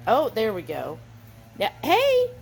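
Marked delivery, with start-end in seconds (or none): 1.6-2.3 surprised cry. Real, not acted. Dog suddenly pushes the door.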